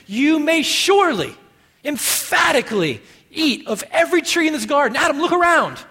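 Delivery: loud, high-pitched emphatic invitation; exuberant command. Acted. Preacher voicing God showing Adam the garden, exuberant. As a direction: urge them on with mounting enthusiasm, high and loud.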